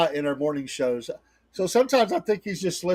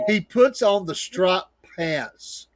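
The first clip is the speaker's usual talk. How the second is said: drawn out for the punchline; slow comic reveal; 2 semitones higher, much slower than usual. Real, not acted. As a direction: stretch each word slowly.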